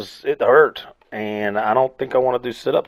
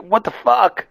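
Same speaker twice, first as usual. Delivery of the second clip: incredulous outburst; 11 semitones higher than usual. Retold or imitated. Acts out disbelief at animal videos.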